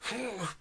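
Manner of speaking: strained puff of breath; demonstrated heavy exhale. Shown as a demonstration. Game master showing a body pushing out poisons, heavy exhale. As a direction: blow out as if fighting poison. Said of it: "you would feel like your body is".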